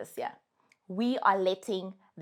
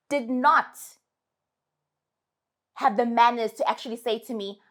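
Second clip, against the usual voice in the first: ordinary talk, nothing marked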